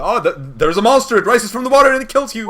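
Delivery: building to a high-pitched, full-volume mock panic; mock dramatic outburst. Game master ribbing silent players with a monster, hammed-up yell.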